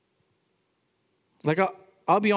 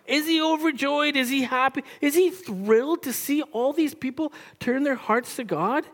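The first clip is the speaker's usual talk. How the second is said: pitched up, disbelieving questions to the church; incredulous rhetorical questions; 8 semitones higher than usual. Acted. Preacher asks the congregation, incredulous and high. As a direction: ask it like the answer is obvious.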